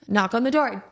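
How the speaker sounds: ordinary talk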